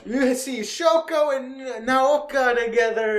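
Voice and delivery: high, loud drawn-out cheer; mock celebration. Viewer recapping Shoko and Naoka together, mock cheering. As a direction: stretch it out in exaggerated glee.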